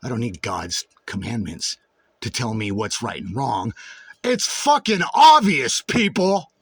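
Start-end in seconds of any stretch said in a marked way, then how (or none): 4.2-6.5 passionate shout, pitched far higher and drawn out; real heated yell. Real, not acted. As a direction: yell it straight at the people. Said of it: "Why am I yelling?".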